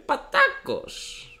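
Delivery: high, slowly stretched surname; imitated headmaster calling. Headmaster rings student in morning, high drawn-out impression. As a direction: draw the name out in a put-on high voice. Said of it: "Με παίρνει, μου λέει".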